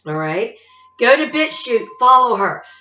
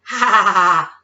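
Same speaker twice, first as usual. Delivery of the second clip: gloating laugh; 3 semitones lower than usual. Real, not acted. Gloating over robot cops.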